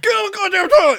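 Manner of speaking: high, loud fed-up shout; mock yell at the pet; exasperated yell. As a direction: yell at the cat to go. Owner yelling at cat.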